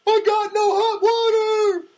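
very high, loud, slow wail; mock dramatic cry. Banderas-style plumber in a movie, hammed-up loud wail.

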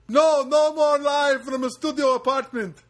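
high, loud mock Middle Eastern accent. Captured terrorist begging, in a loud high mock Middle Eastern accent. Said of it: "I made him sound like Armenian".

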